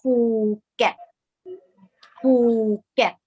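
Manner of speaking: "Phuket" as a slow "Poo... get", hard aspirated P; correct Thai pronunciation. Shown as a demonstration. Teacher modelling the island's name for learners, "Phuket" said correctly as "Poo-get". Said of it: "the correct pronunciation of this beautiful island is".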